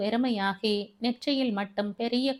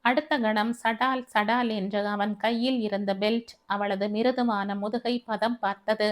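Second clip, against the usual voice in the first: plain reading voice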